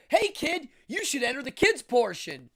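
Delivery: put-on loud, high call to a passing kid; boardwalk hustler calling out. Contest staff calling a kid over to enter. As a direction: hail the kid like a carnival barker. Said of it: "and they go".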